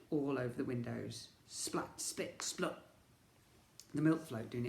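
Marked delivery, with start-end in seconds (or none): 1.5-2.8 unvoiced splat sounds; splatter noise. A narrator's reading. Narrator voicing milk splattering the windows. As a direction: spit out the splats.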